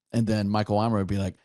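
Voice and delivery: usual speaking voice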